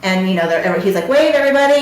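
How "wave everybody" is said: raised, higher call to a room; retold call to everyone in earshot. Staff member telling the patients to wave to a caller, calling out.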